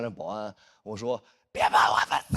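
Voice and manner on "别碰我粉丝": hoarse, voiceless strained yell; hammed-up protective outburst; mock furious yell. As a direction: roar it with no voice left. Acted warning to security guard.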